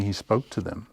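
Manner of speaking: usual speaking voice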